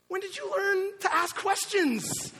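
very high, loud doting squeal; amazed gushing. Retold or imitated. Dad amazed his toddler is talking, high gushing. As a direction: coo in astonishment at a small child.

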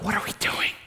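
breathless whisper of nervous disciples; whispered worry. Retold or imitated. Pastor voicing disciples setting off in the boat, whispering worry. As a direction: whisper it like you're afraid to be heard.

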